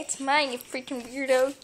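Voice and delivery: ordinary talk, nothing marked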